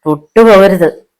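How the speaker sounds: restrained shout of warning; angry outcry. A narrator's reading. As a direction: warn him off angrily. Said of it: "സ്ഥലകാല ബോധം മറന്ന് ആക്രോശിച്ചു".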